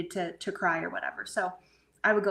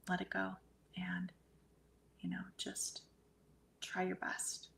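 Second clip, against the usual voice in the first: much softer, halting reassurance; gentle advice, retold; 11 dB quieter than usual. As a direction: quietly counsel someone to release, with long pauses. Retold gentle advice on crossing over.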